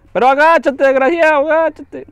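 loud, high furious orders; angry yelling. Real, not acted. Gamer angrily yelling at his ally to duck. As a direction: scream at him to duck.

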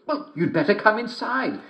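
put-on high old mother voice; mother asking who knocks; mother's voice. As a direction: call through the door like a mother. Mother lets wolf in.

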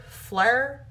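flower said as fleur; flower squashed into fleur with a drawl; country flower. Retold or imitated. Mock country pronunciation of flower for a flower. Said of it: "someone's trying to say flower in a country accent".

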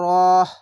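রহ cut off with a loud breathy h; stopping on h. Shown as a demonstration. Teacher showing pupils how to pause on the word, loud. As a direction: stop hard on রহ, ending in a pushed h. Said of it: "এভাবে থামতে হবে".

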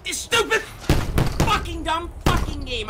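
high-pitched, exasperated cursing; angry outburst. Real, not acted. Gamer cursing a game that misreads his keys, angry outburst. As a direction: burst out at the game in frustration. Gamer rages at glitchy menu.

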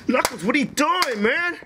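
high-pitched, incredulous outcry; alarmed protest. Acted. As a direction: cry out in disbelief at a friend. Protesting as friend wrecks things.

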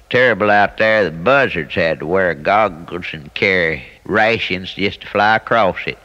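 ordinary talk, nothing marked

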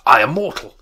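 loud protest; remonstrating. Acted. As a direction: cry out in alarm. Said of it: "Scrooge remonstrated".

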